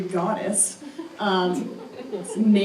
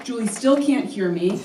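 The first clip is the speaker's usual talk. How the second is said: ordinary talk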